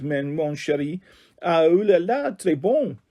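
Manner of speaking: lightly voiced French lady; French accent. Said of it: "I'm good at doing the French accent".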